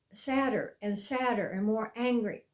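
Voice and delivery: normal talking voice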